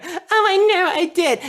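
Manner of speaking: high, delighted little voice; high mock delight; voiced wig-wearer. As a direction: pretend to be the proud owner, sweetly. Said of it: "they're just like".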